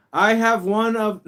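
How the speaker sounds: loud, high, slow mock shouting; hammed-up all-caps read. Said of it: "no, I'm just kidding. It's all caps".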